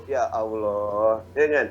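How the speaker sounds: low, slow groaning Ya Allah; fed-up lament; exasperated. Acted. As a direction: drag it out in despair. Fed up with freeloading friend.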